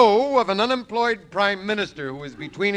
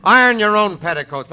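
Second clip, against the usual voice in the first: defiant retort; 4 semitones higher, 8 dB louder than usual. Acted. Husband rebels against wife.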